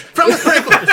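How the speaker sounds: high, laughing repeat. Real, not acted. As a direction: giggle it out high.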